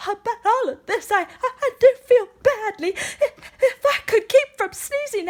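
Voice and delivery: high, fretful elephant voice with laughing sobs; upset elephant. Acted. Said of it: "said the elephant sadly".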